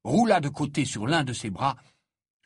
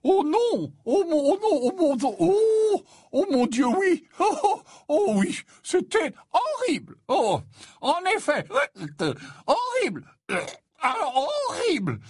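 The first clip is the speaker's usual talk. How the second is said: put-on very high, tipsy, flustered old man; flustered Slughorn. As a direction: stammer out horrified agreement in a high, wailing voice.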